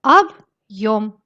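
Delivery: объём split as "ab", pause, "yom"; separated at hard sign. Shown as a demonstration. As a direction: break объём cleanly after the б. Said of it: "Il ne sert qu'à séparer".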